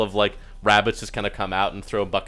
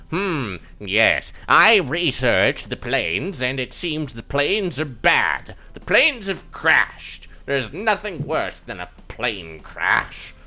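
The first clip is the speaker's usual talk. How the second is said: slow, swooping theatrical puppet voice; pondering scholar elf; 5 semitones higher, 3 dB louder, slower than usual. Acted. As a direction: drag words out like a pompous stage scholar.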